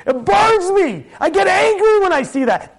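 burn with outrage, dragging out the words; acted zealous fury; righteous anger. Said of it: "That's when the anger gotta come out".

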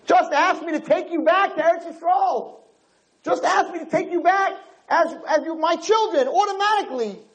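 high, anguished parental appeal; imitated tearful pleading. God begging his children to ask to return, imitated anguished plea. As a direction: plead high, as if close to crying. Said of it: "Hakadosh Baruch Hu cries even more".

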